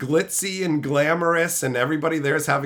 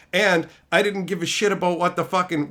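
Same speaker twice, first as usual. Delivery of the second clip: ordinary talk, nothing marked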